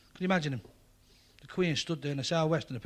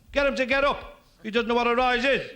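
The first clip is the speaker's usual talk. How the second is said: much higher and louder, yelling from the crowd; shouting; 8 semitones higher, 7 dB louder than usual. Retold or imitated. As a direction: yell it over the crowd at the Queen.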